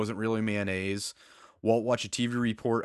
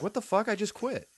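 high, stunned mock disbelief; imitating Jesse's shock; 10 semitones higher than usual. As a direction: play Jesse caught off guard. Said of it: "And Jesse's like".

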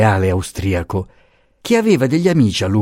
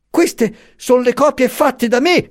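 much higher, earnest pleading voice; anxious old professor; slower than usual. A narrator's reading. Professor urges the marchesa to read his documents, high anxious pleading. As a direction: press the papers on her nervously.

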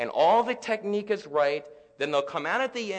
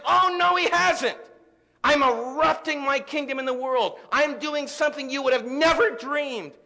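higher and louder, a triumphant proclamation; voice of God; 10 semitones higher, 6 dB louder than usual. Acted. God overturning the disaster at the cross, triumphant and loud. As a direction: answer the despair as God would, ringing out. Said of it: "and God says".

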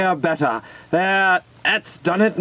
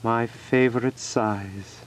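sad; 6 semitones lower, 3 dB quieter than usual. Acted. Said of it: "he said to himself sadly".

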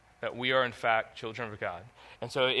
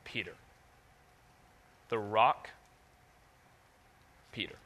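ordinary delivery

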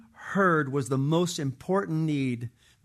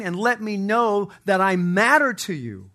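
raised, ringing plea to God; impassioned preaching; 4 semitones higher, 4 dB louder than usual. Acted. Preacher voicing David crying out to God, impassioned and loud. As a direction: cry out for help from the pulpit.

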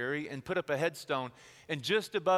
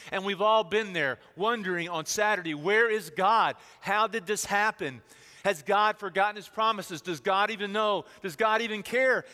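louder, pleading cries to heaven; anguished questioning; 5 semitones higher, 8 dB louder than usual. Acted. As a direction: demand answers from God in grief. Voicing doubt on Saturday.

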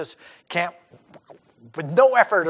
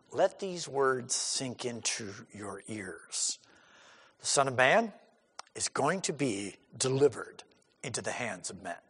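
hushed private words; 8 semitones lower, 12 dB quieter than usual. Retold or imitated. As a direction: draw them close and murmur it.

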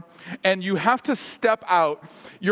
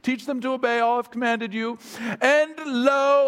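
higher-pitched, formal British stage recitation; lilting mock-Shakespearean scripture reading; 4 semitones higher than usual. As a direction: intone it with British theatrical formality. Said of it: "kind of Shakespearean lilt".

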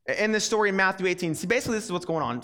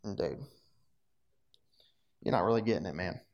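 laid-back Jesus; 9 semitones lower, 6 dB quieter than usual. Retold or imitated. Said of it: "Jesus is just like".

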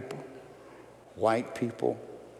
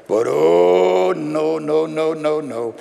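rising preacher's no, no, no; preaching; 3 semitones higher, 9 dB louder, slower than usual. Acted. Preacher refusing bitterness before the congregation. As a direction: build the denial like a pulpit cry.

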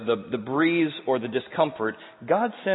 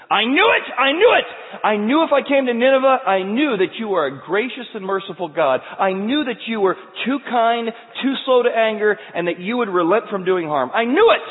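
shouted, much higher and louder; furious Jonah; 9 semitones higher, 8 dB louder than usual. Acted. Preacher acting Jonah yelling at merciful God, full shout. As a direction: rage at God for being too kind.

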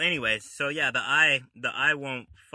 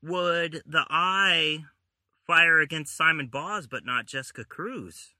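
incredulous; 6 semitones higher, 7 dB louder, slower than usual. Real, not acted. Disbelieving question about the comic.

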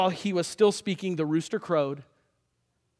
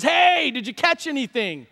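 slow, high call across distance; 8 semitones higher, 4 dB louder than usual. Acted. Stranger on shore hails fishermen, calling out. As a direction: holler it out to a far boat. Man calls from shore to boat.

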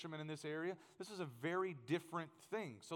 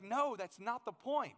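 mock irritated guide; 6 semitones higher, 5 dB louder, slower than usual. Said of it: "the guy was getting really angry".

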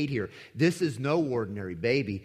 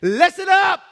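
"Listen, listen up" thundered much higher and far louder, "up" held; thundering command; 12 semitones higher, 12 dB louder, slower than usual. Acted. God thundering from heaven at the disciples, booming command. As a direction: boom it down from heaven. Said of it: "God thundered from heaven".

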